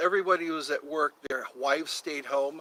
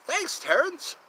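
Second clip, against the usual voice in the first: high thanks; 11 semitones higher than usual. Pretend. Thanking chatter Terrence.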